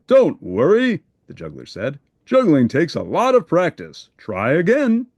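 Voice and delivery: a kind teacher cheering a beginner on; encouraging juggler. Acted.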